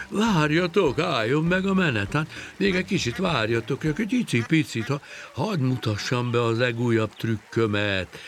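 high, urgent begging; pleading. Acted. Fisherman begging the departing fish to stay, high pleading. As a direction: beg them to wait, words tumbling on. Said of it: "Könyörgésre fogta a dolgot".

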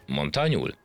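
ordinary delivery

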